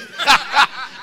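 big high burst of laughter; real laughter; loud laugh. Real, not acted. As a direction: break into a hearty laugh. Preacher laughing hard.

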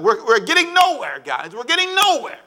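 hammed-up whining plotters; frustrated Pharisees. Pharisees complaining among themselves, mock frustrated. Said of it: "It's like".

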